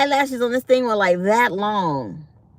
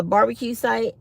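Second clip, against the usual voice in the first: normal talking voice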